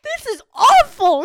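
high, loud mock wail; retold upset. Host tells her boyfriend about the case, high loud wail. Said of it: "And I was like".

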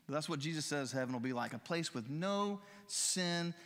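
ordinary talk, nothing marked

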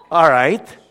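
normal talking voice

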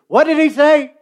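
put-on high, loud, shouted question from a deaf old lady; hard of hearing lady. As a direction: yell it like she can't hear a thing. Deaf lady on falling plane.

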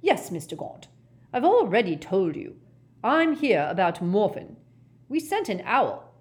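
insistent, patience wearing thin; exasperated. Acted. Ogden explaining his visit to Gaunt, exasperated.

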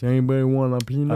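lightly voiced gentle giant; Andre the Giant voice. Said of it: "doing the Andre the Giant voice".

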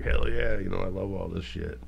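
ordinary talk, nothing marked

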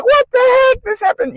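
very high, astonished exclamation; amazed disbelief. Retold or imitated. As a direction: shriek of shocked wonder, then dropping. Whole table amazed at money.

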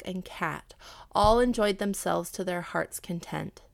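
plain reading voice

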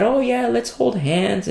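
high, slow, sugary put-on couple; mock lovey-dovey. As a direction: play the fake happy spouse. Mocks fake loving church couple.

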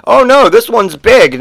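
high, loud hyped-up insistence; mock alarm. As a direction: oversell the big news. Acted alarmed bearer of news.

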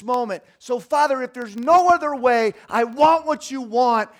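loud, high preaching cry; Jesus's surrender, preached in a loud, high cry; impassioned shout. Retold or imitated. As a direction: cry it out with full conviction. Voice of Jesus preached at full force.

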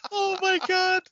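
very high squealing disbelief; real giddy astonishment, pitch way up; amazed, laughing protest. Real, not acted. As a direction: protest breathlessly high, cracking up. Helpless real reaction to the pick.

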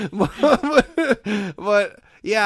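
real laugh breaking through. Real, not acted. Laughing after story.

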